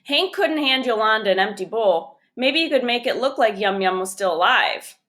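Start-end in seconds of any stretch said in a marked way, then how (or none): none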